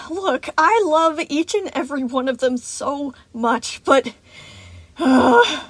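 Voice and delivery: loud, high, strained insistence; frazzled caregiver losing it; crazed exasperation. A narrator's reading. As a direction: barely hold it together, ending in an exasperated outburst. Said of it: "he was looking a bit crazed now".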